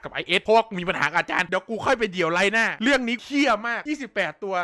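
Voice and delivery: ordinary delivery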